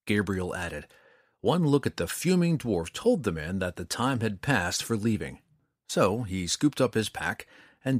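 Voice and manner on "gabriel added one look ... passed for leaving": ordinary delivery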